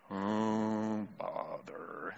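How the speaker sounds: very low, soft, gloomy donkey voice; Eeyore. Acted. Preacher showing who doesn't rejoice, glum Eeyore impression. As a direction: sigh it out, deep and dejected. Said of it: "When Eeyore talks, he's not rejoicing".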